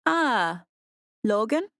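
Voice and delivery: put-on bright, rising recognition in an African accent; an older woman with an African accent catching on; heavy African accent. A narrator's reading. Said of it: "in her heavy African accent".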